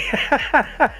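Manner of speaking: high burst of chuckles; sudden real laughter; amused laugh. Real, not acted. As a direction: laugh as the joke lands. Reacts to chat joke.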